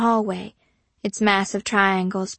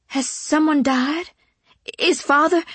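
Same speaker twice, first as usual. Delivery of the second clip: anxious question; 6 semitones higher than usual. A narrator's reading. Daughter fears news of death.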